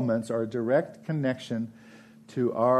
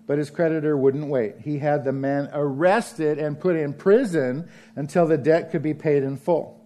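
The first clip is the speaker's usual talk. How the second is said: usual speaking voice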